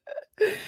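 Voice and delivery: high trailing giggle; real laugh. Real, not acted. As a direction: let out a last high giggle. Laughs at viewer's comment.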